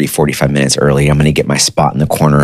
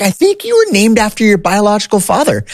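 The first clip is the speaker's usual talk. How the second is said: mom breaking the news. Retold or imitated. Mom voiced revealing the truth.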